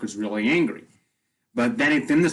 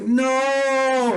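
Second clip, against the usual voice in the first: very high, wailing mock cry of grief; a retold anguished "No!"; 12 semitones higher than usual. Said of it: "she starts to cry".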